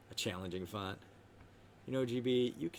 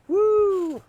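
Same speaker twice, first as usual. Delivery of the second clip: excited; 13 dB louder than usual. Real, not acted. Cheering loudly.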